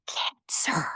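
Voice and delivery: hushed unvoiced whisper of "Cancer"; secretive whisper of a taboo word. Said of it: "or you went".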